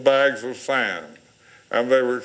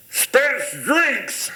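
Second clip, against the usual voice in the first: calling out; 13 semitones higher than usual. Retold or imitated. Friend calling to passing cars.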